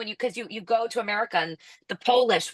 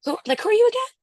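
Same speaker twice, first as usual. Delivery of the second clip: high, louder mock puzzlement; puzzled retold question; 5 dB louder, much faster than usual. Locals ask the returning visitor who they are, imitated puzzlement. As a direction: imitate someone squinting to place you, pitched way up.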